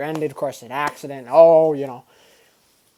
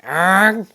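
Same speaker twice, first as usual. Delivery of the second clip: engine roar; 4 semitones higher, 7 dB louder than usual. Retold or imitated. Imitates his car gunning past.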